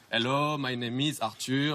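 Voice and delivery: slow, played-up French accent; French style. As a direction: put on the French charm slowly. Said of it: "I must to keep my French style".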